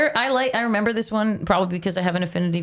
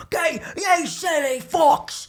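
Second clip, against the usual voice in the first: high, drawn-out mock yell; yelling coach; 12 semitones higher, much slower than usual. Podcaster imitating the rival coach yelling at kids, mock yell. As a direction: holler it slowly, pitched way up. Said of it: "like, yelling at the kids".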